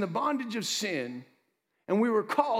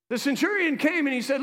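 usual speaking voice

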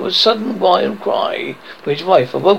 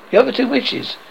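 ordinary delivery, nothing marked

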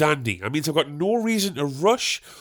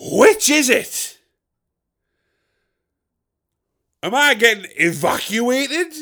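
much higher and louder, building to a shout; incredulous rant; 8 semitones higher, 6 dB louder than usual. Acted. Comic rant at the hotel lady, higher and shouting. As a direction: demand an answer at full volume, outraged.

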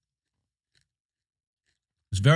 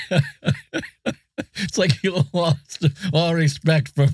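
laughing; 5 semitones higher than usual. Real, not acted. Teasing friend about his disdain.

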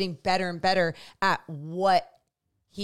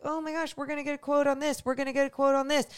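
excited family; 7 semitones higher, faster than usual. Retold or imitated. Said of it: "they're like".

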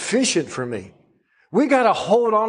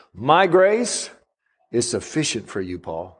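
gentle answer from God; 7 semitones lower than usual. Retold or imitated.

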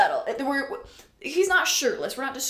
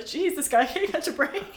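exasperated sympathy; 8 semitones higher, 3 dB louder than usual. Acted. Voices a reader's exasperated sympathy.

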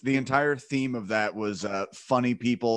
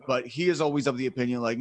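usual speaking voice

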